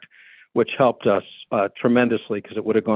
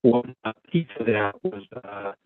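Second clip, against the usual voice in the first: cutting out; 5 dB quieter than usual. Real, not acted. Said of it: "you are cutting out on our end a little bit".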